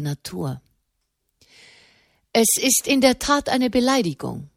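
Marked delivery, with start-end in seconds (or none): 2.3-4.5 higher-pitched man's voice, formal; oddly high voice. A narrator's reading. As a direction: lift the voice high for a powerful man. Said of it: "Seine Stimme klang merkwürdig hoch". (merkwürdig hohe Männerstimme)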